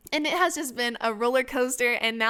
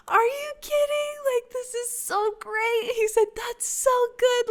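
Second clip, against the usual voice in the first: delighted disbelief; 11 semitones higher than usual. Retold or imitated. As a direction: gush in amazement, pitched way up. Husband's delighted reaction to pregnancy.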